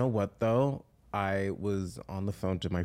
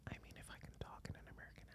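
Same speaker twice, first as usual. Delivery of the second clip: American-accent whisper; much faster than usual. Shown as a demonstration. Answering a friend's challenge to whisper, American whisper. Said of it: "can you whisper an American accent".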